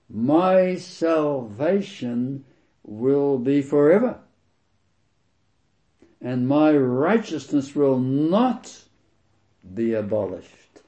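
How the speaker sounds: slow, lifted scripture reading, words drawn out; slow proclamation. Real, not acted. Preacher reading scripture to the congregation, slow and lifted. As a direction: proclaim each word slowly as a promise.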